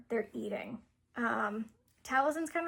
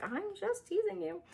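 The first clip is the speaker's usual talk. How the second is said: much higher, light teasing voice; playful teasing; 8 semitones higher than usual. Acted. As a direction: toss it off higher like a tease. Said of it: "Alaric is teasing her".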